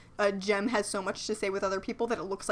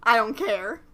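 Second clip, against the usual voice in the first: ordinary delivery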